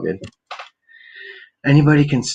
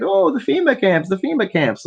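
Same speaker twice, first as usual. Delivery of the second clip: mocking worried people; 9 semitones higher than usual. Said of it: "Everybody's like".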